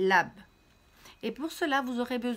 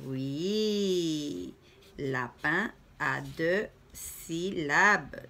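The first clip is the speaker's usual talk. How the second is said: très lent et appuyé; 3 semitones lower, much slower than usual. Shown as a demonstration. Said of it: "explique lentement".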